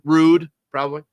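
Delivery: ordinary talk, nothing marked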